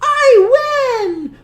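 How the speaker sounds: high, delighted cry of victory; high gleeful old woman's shout; gleeful granny. Acted. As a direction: crow over the win like a happy granny. Baba Brena triumphant.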